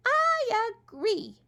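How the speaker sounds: very high, slow drowsy squirrel; sleepy little voice. Acted. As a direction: murmur like a tiny sleepy critter. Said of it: "he said in a sleepy little voice".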